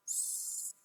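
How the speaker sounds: sad drawn out sound by sound; slow segmented sad; stretched out slowly. Shown as a demonstration. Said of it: "Let's say sad really slowly".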